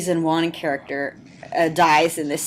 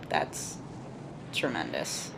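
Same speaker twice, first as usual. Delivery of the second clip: sarcastic deadpan; 13 semitones lower, 12 dB quieter, slower than usual. Real, not acted. Sarcastic comment on the burning memorial.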